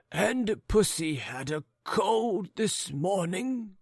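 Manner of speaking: put-on high, slow, puzzled old man; a doddering old husband wondering aloud; fussy old gentleman. Old husband puzzling over the barking dog, high and slow.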